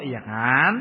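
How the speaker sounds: usual speaking voice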